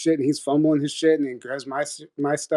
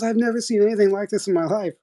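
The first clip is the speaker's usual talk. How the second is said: higher-pitched imitation of exasperated disbelief; incredulous dad; 8 semitones higher, faster than usual. Dad to me after the doors slammed, incredulous. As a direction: retell dad's disbelief in a raised pitch. Dad's outraged reaction, retold.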